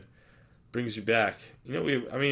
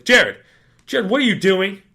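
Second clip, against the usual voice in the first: high, much louder scolding call of his name; mock shouting principal; 10 semitones higher, 14 dB louder than usual. Assistant principal catches him smoking at the game, high loud shout.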